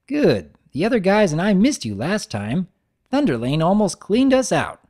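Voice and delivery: chatty, cheerful shopkeeper voice; Mister Cake voice. Acted. Baker welcoming his poker buddy back, cheerful.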